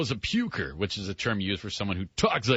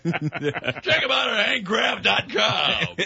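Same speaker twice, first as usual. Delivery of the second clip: higher, louder announcer ad voice; radio sponsor read; 8 semitones higher, 4 dB louder than usual. Shown as a demonstration. Host parodying his old station's sponsor plug, announcer ad read. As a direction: sell it like a cheesy radio spot. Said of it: "mock radio sponsor read".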